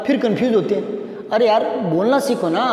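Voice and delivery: usual speaking voice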